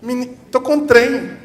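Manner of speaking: put-on high, loud little child's voice; a child struggling to name a feeling; child complaining. Child telling a mother from Minas about a bad feeling, whiny. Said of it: "a criança chega pra mãe mineira e fala assim".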